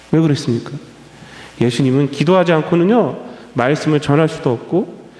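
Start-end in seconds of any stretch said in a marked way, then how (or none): none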